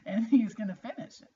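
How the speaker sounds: laughing through the words. Real, not acted. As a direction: chuckle it out, amused at his struggle. Mom recalls son's struggle, laughing.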